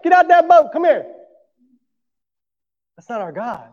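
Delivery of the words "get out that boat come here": high, loud mock shout; mock yell. Preacher mocking a yelling Jesus, loud high shout. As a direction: bark it across the water in a put-on yell. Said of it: "Jesus yelled at him out of the boat".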